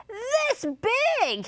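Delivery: very high, stretched chimp cry; high-pitched storybook exclamation. A narrator's reading. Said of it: "he cried".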